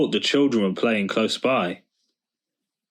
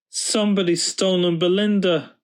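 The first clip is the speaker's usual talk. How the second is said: put-on high sobbing children's voice; high tearful child wail; sobbed; 9 semitones higher than usual. A narrator's reading. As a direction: sob it out in distress. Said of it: "sobbed the children".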